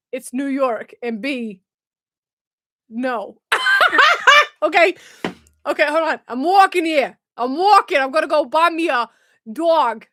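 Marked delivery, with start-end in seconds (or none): 3.4-4.6 loud, high-pitched laughing fit; real high giggle burst. Real, not acted. Cracks up at accent attempt. 6.3-10.1 put-on loud, pushy New York accent; hammed-up New York street accent. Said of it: "it's a New York accent".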